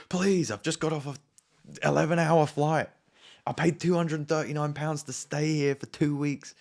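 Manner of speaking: high, loud, whiny begging; loud high whine about the long flight; mock desperate pleading. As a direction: beg the intercom lady like a desperate man. Pleading with the intercom lady.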